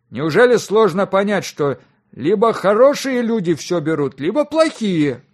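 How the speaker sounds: high and loud, drawn out at the end; exasperated scolding. A narrator's reading. Father lecturing his naive son on bribes, exasperated. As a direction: hammer the point home in exasperation.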